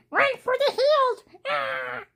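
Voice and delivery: very high, loud mock panic; cat voiced in high mock terror; panicked cat voice. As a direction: squeal like a frightened kitten fleeing. Voicing a scared cat fleeing.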